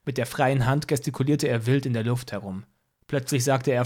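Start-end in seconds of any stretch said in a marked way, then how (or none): none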